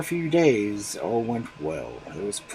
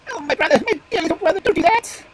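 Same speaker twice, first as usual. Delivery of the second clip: much higher and louder, crying out in anguish; 9 dB louder than usual. Acted. Magpie begging the fox not to cut her tree, anguished cry. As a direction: plead desperately with the fox. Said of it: "cried the magpie".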